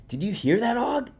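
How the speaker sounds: high-pitched little hamster voice; excited hamster. A narrator's reading. Classroom hamster to his frog friend, excited. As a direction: pipe up high, thrilled with the news.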